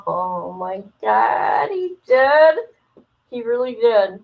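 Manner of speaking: ordinary talk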